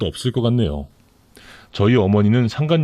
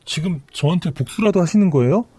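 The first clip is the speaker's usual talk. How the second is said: higher, tearful-sounding plea from the mistress; pleading mistress; 6 semitones higher than usual. Acted. Mistress begging her lover's sister for help, pleading.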